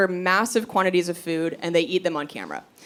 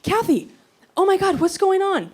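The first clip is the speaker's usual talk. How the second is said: alarmed nurses; 11 semitones higher than usual. Retold or imitated. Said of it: "They're like".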